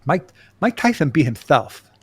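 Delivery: lightly mimicked lisping boxer. Host quips the boxer beat himself, light lisping Tyson impression.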